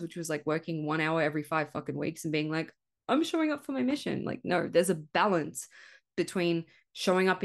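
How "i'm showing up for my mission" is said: much higher, sing-song mockery; hammed-up mock earnest; mocking self-quote. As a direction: parody your smug old self. Mocking her old lazy self.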